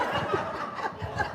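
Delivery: soundless airy laugh; a laugh caught under the breath; silent laugh. Real, not acted. Laughs at own reaction.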